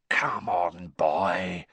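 breathy urging whisper. Acted. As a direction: lean in and whisper the hint. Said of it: "whispered Moody".

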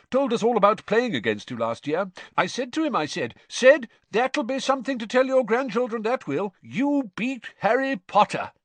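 high, loud, proud dad voice; boastful, genial father. A narrator's reading. Amos boasting about his son beating Harry, proud and hearty.

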